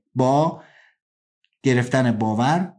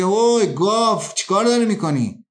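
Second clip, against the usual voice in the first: rude yell; 6 semitones higher than usual. Acted. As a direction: bark at him like a rude biker. Rude motorcyclist yells at him.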